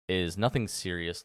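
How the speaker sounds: ordinary delivery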